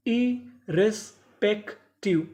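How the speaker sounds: Irrespective split slowly as इ-रेस-पेक-टिव; slow teaching breakdown of irrespective; syllable by syllable. Shown as a demonstration. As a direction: pause between each chunk of irrespective. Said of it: "टुकड़ों में तोड़ तोड़ के पढ़ें".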